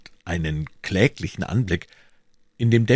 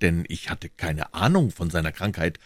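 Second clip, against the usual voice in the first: ordinary talk, nothing marked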